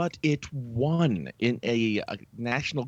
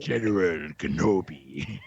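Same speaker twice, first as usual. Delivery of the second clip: General Grievous impression; 7 semitones lower, slower than usual. Grievous meme reply, low slow.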